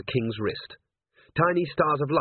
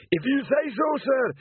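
high, earnest troll voice, raised; a big dim troll, eager to help; troll Detritus. A narrator's reading. Troll sergeant offering Vimes the chalk, eagerly. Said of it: "Detritus answering".

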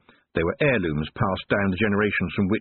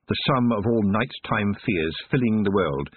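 ordinary delivery

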